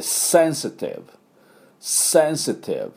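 "センシティブ" as English sensitive, starting on a long hissed S; pronunciation demo. Shown as a demonstration. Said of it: "Sの音がもうポイントです". (「センシティブ」をSを強めた英語発音で)

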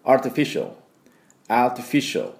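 English アーティフィシャル with a reduced final syllable; correct English model. Shown as a demonstration. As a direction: barely voice the A in the シャル of アーティフィシャル. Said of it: "これぐらいです".

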